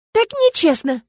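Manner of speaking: loud, high cry of unfairness; aggrieved exclamation, voice jumping up; protesting outburst. A narrator's reading. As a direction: let the protest burst out. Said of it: "вырвалось у меня".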